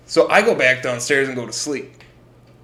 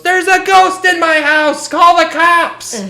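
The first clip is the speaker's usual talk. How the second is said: high, loud frantic scream; panicked yell; 12 dB louder, slower than usual. Retold or imitated. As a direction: shriek it like a terrified mom. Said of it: "runs outside and goes".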